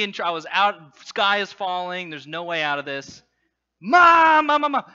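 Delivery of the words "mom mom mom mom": put-on high, loud, repeated child's call; yelling for mom. Boy with no way out calls mom, yelling. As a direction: holler urgently for your mother.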